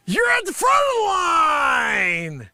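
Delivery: loud, high and drawn-out outburst; exasperated shout. Acted. Man yelling at the shopper ahead in line, loud and high. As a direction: yell at the woman blocking him.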